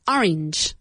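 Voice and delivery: "orange" with OR pushed; stress on the first syllable. Shown as a demonstration. Teacher modeling a word for students, first syllable stressed. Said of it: "Emphasis on the first syllable".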